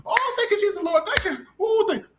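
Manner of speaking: sarcastic falsetto caricature of a grateful servant; mocking mammy voice. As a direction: squeal thanks to Jesus like a caricatured mammy. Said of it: "Or the, or the mammy".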